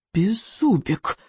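slow, drawn-out hushed warning; warning hiss. A narrator's reading. Hiccup warns his straying dragon, drawn-out hiss. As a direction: stretch the name out as a warning. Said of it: "предостерегающе зашипел Икинг".

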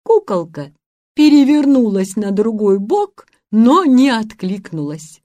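ordinary delivery

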